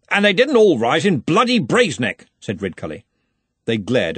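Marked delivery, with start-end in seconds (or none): 0.1-2.3 louder, biting comeback; angry retort. A narrator's reading. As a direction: snap back in open anger.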